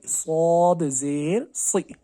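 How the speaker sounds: सौद ज़ेर सी with a thick, heavy "si"; heavy saad. Shown as a demonstration. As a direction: spell it out slowly, the s full and heavy. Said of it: "मोटा पढ़ना है".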